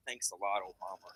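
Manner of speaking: low, soft deadpan Obama; mock Obama. President with a too-big cookie, low deadpan. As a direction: drop low and sigh the complaint lightly. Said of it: "and he's like".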